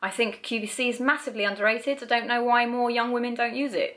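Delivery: ordinary talk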